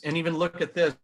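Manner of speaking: ordinary delivery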